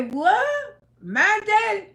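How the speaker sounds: put-on retelling her own childhood shock; shocked disbelief. Shocked reply in retold scene.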